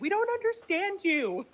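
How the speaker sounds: high, slow confused mimicry; mocking Americans. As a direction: play the baffled American, drawn out high. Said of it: "'cause we're like".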